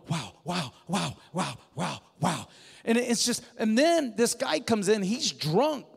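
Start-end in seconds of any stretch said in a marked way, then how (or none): 0.1-2.5 lightly voiced old guy, awed; old man's wow. Old guy in the church marveling, lightly voiced awe. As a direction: murmur a small stunned wow, wow. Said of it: "an old guy going".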